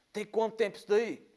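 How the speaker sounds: high-pitched demanding question as Dad; alarmed father. Retold or imitated. Father questioning his son about the wound, alarmed. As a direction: imitate a worried father demanding an answer.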